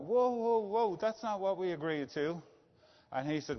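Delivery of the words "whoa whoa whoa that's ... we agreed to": retold whoa, pitched higher; a jolt of surprise, then objecting; startled protest. Alan at the board meeting, protesting the sale in startled surprise. Told company will be sold.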